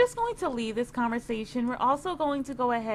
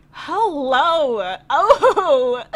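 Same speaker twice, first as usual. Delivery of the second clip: flirty hello; 3 semitones higher, 11 dB louder, much slower than usual. Real, not acted. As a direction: call out to him in delight. Flirting with cute guy.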